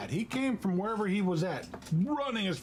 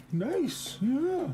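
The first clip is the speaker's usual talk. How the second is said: sing-song pet praise; 10 semitones higher than usual. Real, not acted.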